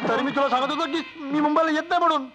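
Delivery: high-pitched, plaintive wail; panicked wailing. Acted. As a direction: wail it like a frightened complainer. Captive friends blame each other, panicking.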